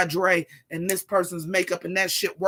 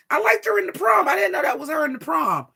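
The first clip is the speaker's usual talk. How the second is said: much higher, loud excited cry; excited shout; 7 semitones higher, 5 dB louder than usual. Real, not acted. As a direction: burst out with delight. Excited outburst about Ariana DeBose.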